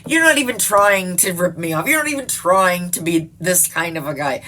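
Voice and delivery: loud, exasperated telling-off; mock scolding. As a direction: berate a useless scammer loudly. Retells scolding the inept scammer.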